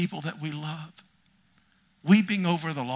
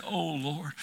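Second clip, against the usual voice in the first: soft prayer sigh; 9 dB quieter than usual. Acted. Quiet prayer cry.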